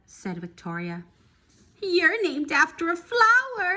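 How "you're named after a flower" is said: mocking sneer. Victoria mocking her name.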